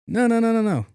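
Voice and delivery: high, sustained run of no's; rapid-fire denial. Retold or imitated. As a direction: bat the idea away in a high voice. Correcting his own wrong assumption.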